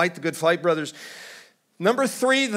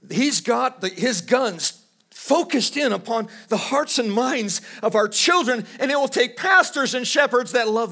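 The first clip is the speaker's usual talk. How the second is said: rising preaching; 7 semitones higher than usual. Acted.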